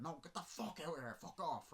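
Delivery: mock angry dismissal. Mother chasing him off.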